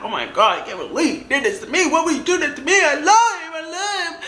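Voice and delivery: mock heartbroken wail, climbing high; hammed-up tearful lament; imitated crying. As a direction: build from dismay into a high-pitched wail. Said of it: "I was crying".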